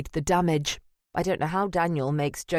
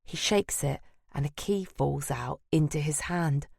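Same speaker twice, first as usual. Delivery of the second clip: usual speaking voice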